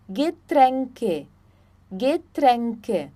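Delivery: Getränke with ä as e, ge-TREN-ke; German pronunciation. Shown as a demonstration. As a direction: model Getränke clearly. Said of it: "o A tremado sempre vai ter som de E".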